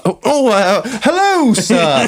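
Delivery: high, startled hello sir; flustered surprise. Retold or imitated. Flustered high greeting to the firearms officer. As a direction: caught off guard by the licensing officer. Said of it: "I was like".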